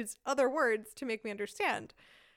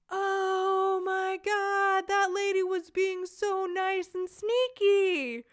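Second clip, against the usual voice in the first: much higher, louder, slow delighted gush; delighted realization; 8 semitones higher, 5 dB louder, much slower than usual. Retold or imitated. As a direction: gush over the nice sneaky lady. Realizing lady was being kind.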